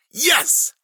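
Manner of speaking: high, loud burst of victory; exultant high yell; triumphant cry. A narrator's reading. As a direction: shout it out in triumph. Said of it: "Milo cried".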